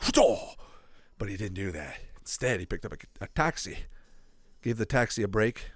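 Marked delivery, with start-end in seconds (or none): 0.0-0.5 short, high burst with a quick drop; imitated charge noise. Showing how Juggernaut would charge, mock burst. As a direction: blurt it like a sudden charge. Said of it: "go like".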